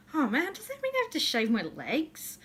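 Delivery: high, whiny-style mock complaint; mock dismay. Vlogger dreading shorts season, high mock dismay. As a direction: lament it with playful dread. Acting out own summer dismay.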